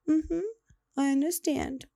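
put-on high little toddler voice; small child agreeing. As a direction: imitate a young child meekly repeating back. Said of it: "She said".

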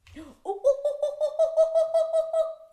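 high, loud run of monkey oohs and aahs; monkey hooting. Pretend. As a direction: pretend monkey chatter. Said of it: "Shall we all pretend to be monkeys?".

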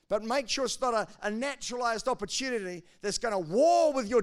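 loud, high preaching; preaching at full force. Acted. Pastor to his church, loud and rising. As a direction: drive the message home hard.

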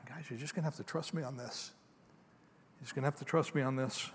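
very soft, tender reassurance; gentle God voice. Acted. God tenderly reassuring Abraham and Sarah, very soft. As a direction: hush it, trust me.

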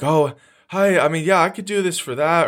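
high, over-eager mock pleading; needy. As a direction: voice goes up like you need the job. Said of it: "your voice goes up when you're needy".

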